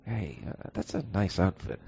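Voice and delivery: soft, creepy whispered compliment; Adam West villain whispering; Whisperer villain. Pretend. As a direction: purr it like a campy villain. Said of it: "it's the Whisperer Batman".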